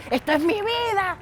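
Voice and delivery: imitated yell. Preacher imitating a football friend raging after a loss, mock high cry. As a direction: ham up the sore loser's despair. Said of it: "empezaba a gritar y decía".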